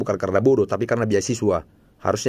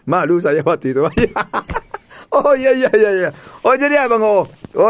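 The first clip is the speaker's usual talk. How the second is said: laughing; 10 semitones higher, 4 dB louder than usual. Real, not acted. Embarrassed laughter over the mistake.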